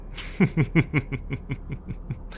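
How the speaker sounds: soft, drawn-out snicker; amused chuckle. Acted. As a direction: chuckle softly to yourself. Soldier amused by captive.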